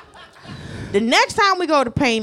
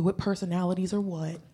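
usual speaking voice

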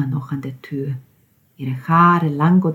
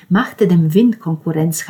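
usual speaking voice

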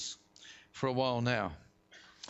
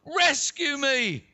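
high, loud, drawn-out plea; yell; 7 semitones higher, 6 dB louder, slower than usual. A narrator's reading. Peter sinking in the waves to Jesus, yelling for help. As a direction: yell desperately for rescue. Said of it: "he yelled".

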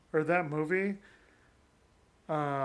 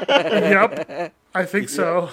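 laughing reply; 2 semitones higher, 13 dB louder, much slower than usual. Retold or imitated. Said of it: "And I'd be like".

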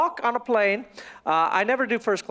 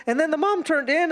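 ordinary delivery

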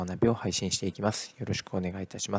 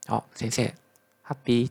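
higher-pitched imitation of a delighted child greeting a teacher; cheerful pupil; 5 semitones higher, 4 dB louder than usual. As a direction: call out with a smile, lightly playing the pupil. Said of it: "笑顔でね". (笑顔で呼びかける生徒の真似)

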